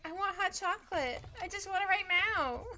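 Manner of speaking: lightly acted impatient kid-like plea. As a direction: play up wanting it this very second. Voicing own impatient whining.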